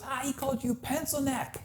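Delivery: high, sing-song taunt; mocking tease. Cousin mocking his friend about the insult, high sing-song taunt. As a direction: needle him in a high teasing voice.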